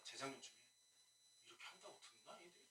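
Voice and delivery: ordinary talk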